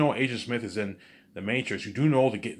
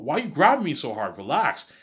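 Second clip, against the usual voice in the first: annoyed protest; 7 semitones higher, 7 dB louder than usual. Acted. Character protesting being grabbed.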